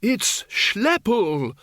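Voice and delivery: high, drawn-out cry; excited shout. A narrator's reading. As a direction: call out the name slowly and high. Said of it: "shouted Windle".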